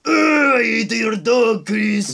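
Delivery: put-on slow crying Japanese accent; mock Japanese accent. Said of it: "do a racist Japanese accent".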